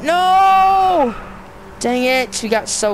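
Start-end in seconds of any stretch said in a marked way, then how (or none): 0.0-1.2 much higher, louder shouted no; shouted high despairing no; anguished shout. Real, not acted. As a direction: howl as the race is lost. Loses the race run.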